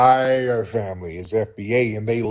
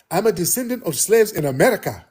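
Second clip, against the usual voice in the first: mock African-accented voice, pitched up; imitated African accent; 7 semitones higher, faster than usual. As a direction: mimic her African accent, higher pitched. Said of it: "I hear an accent".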